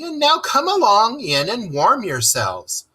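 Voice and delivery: high, hearty invitation; hearty Rat. A narrator's reading. Rat inviting the field mice inside, hearty and high. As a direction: welcome them in heartily. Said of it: "cried the rat heartily".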